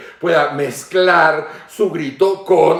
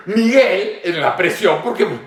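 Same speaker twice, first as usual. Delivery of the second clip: ordinary talk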